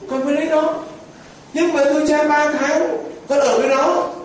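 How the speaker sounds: high, whining wife's voice pleading to the priest; complaining young wife. Retold or imitated. Young wife begging the priest for a divorce, high whining voice. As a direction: pitch it up and plead, half laughing at the devil husband. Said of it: "thế con vợ ghê quá".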